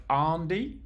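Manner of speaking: ANDY with a long open "ah", like "Ahn-dee"; misheard British Andy. Shown as a demonstration. Streamer showing how Americans hear the name, ANDY said as "Ahn-dee". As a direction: stretch the first vowel of ANDY to "ahn". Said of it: "if I say it normally, apparently it can sound like".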